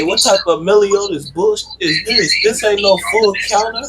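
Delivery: high-pitched, incredulous complaint; indignant protest. Acted. Rival protests at the returned attack.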